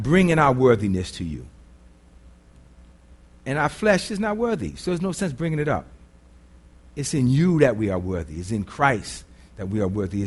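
low, soft, earnest prayer voice. Real, not acted. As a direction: pray quietly for the room. Leads a closing prayer.

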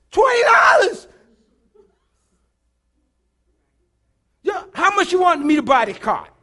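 high, loud furious shout; about to cuss out. Retold or imitated. Preacher almost cussing out the homeless man, shouting. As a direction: yell it at him in outrage. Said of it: "I was gonna cuss him out".

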